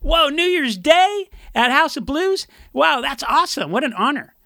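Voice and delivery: high, gushing delight over the gig; excited singer. Retold or imitated. Bandmate gushing over a New Year's Day gig, excited. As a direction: rave about the booking, wide-eyed. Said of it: "and the singer goes".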